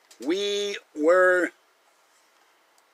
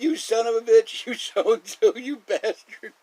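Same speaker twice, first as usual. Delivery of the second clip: mock yelling; 7 semitones higher than usual. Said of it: "And they're yelling".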